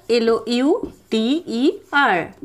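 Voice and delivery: letters spelled out one at a time, slow; spelling slowly. Shown as a demonstration. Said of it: "spelling louder".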